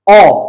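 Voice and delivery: औ as a round open sound between आ and ओ; open o demo. Shown as a demonstration. Teacher demonstrating the English-style औ sound for students. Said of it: "इनके बीच की ध्वनि है ये".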